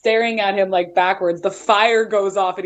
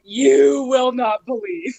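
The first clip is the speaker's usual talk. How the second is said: slow, higher, word by word; emphatic retelling; 4 semitones higher, slower than usual. Retold or imitated. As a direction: stretch it out for dramatic effect. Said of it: "and I was like".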